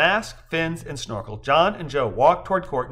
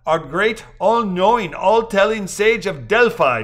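mock reverence; 5 semitones higher, 5 dB louder than usual. A narrator's reading.

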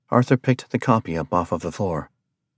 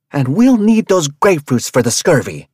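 loud, hard demand, higher; angry demand; 7 semitones higher, 9 dB louder than usual. Acted. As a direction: jab every word at him. Rabia jabbing his chest.

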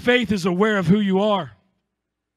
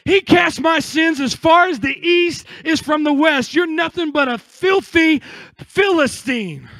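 defiant declaration; 4 semitones higher than usual. Acted. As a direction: tell your failure off. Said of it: "look at that failure and say".

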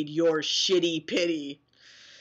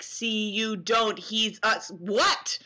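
ordinary talk, nothing marked

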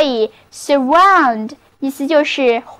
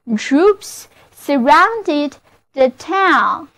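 slow model reading; much slower than usual. Shown as a demonstration. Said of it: "就是".

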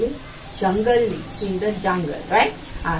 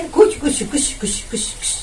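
chugging fading to a whisper; train chugging; 4 semitones higher than usual. Shown as a demonstration. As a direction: let the train noise die away.

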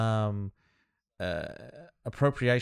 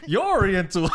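laughing retold quote; 4 semitones higher, 5 dB louder than usual. Retelling being called Oriental, laughing.

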